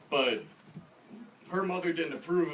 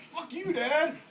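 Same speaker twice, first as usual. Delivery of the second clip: high-pitched mock bratty retort; hammed-up teenage comeback; defiant son; 14 semitones higher, slower than usual. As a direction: squeal back at Dad as a rebellious kid. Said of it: "And be like".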